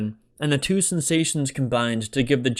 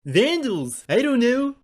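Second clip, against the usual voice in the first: put-on excitable young guy; 13 semitones higher, 4 dB louder than usual. A narrator's reading. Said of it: "the vague answer".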